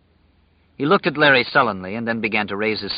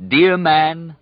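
mocking pirate; 7 semitones higher, 5 dB louder than usual. A narrator's reading. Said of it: "the pirate said".

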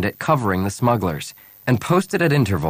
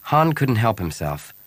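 ordinary delivery

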